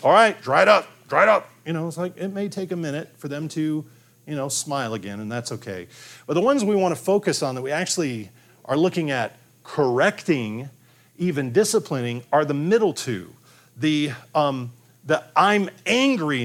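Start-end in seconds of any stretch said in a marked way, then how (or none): 0.0-1.5 louder and higher, brusque order to stop crying; mock stern parent barking "dry it up"; stern parent snap. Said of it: "You're not saying". 15.4-16.4 put-on angry child. Shown as a demonstration.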